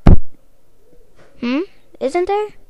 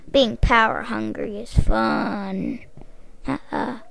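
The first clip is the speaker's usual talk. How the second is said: ordinary talk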